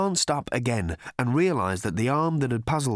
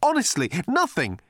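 insistent; 12 semitones higher, 4 dB louder than usual. Acted. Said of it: "insisted Arthur".

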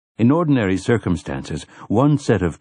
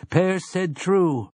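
slow affirmation; 10 semitones higher, slower than usual. A narrator's reading. Speaker continues, slow.

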